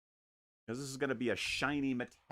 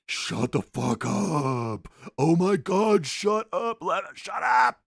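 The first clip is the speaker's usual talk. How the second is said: loud, slow and higher angry snap; mock yell; 8 semitones higher, 10 dB louder, slower than usual. Fed-up partner telling the painter to shut up, a loud mock yell. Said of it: "she's like".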